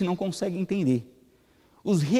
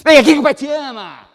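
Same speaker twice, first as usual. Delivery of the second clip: high, loud barked call to a little daughter; father bellowing at his small daughter, harsh; harsh shout; 11 semitones higher, 10 dB louder than usual. Shown as a demonstration. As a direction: yell the loving words like a scolding. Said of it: "Já pensaram se eu chegasse assim pra minha filha".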